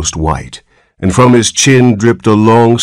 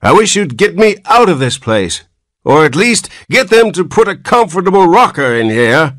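put-on querulous old man; 11 semitones higher than usual. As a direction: complain like a cranky old man. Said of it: "went on the old man querulously".

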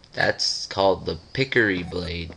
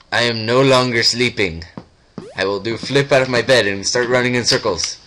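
loud, forceful announcement; sudden loud waking; 2 semitones higher, 7 dB louder than usual. Pretend. Player's game character no longer sleeping, loud declaration. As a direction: burst out of the drone at full voice.

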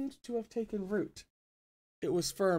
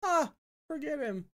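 breezy high dismissal; light and airy; 10 semitones higher than usual. Acted. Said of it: "his voice light and airy".

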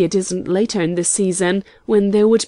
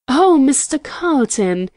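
higher, mildly excited exclamation; breathless exclamation; 5 semitones higher, 4 dB louder than usual. A narrator's reading. Young woman interrupts Carlton with news, breathless exclamation. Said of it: "she exclaimed breathlessly".

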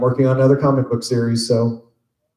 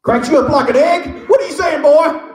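much higher, louder Texas bird, offended and shouting; angry bird character; 5 dB louder than usual. Acted. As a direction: snap back like an insulted badass bird. Voicing Benedict for fans.

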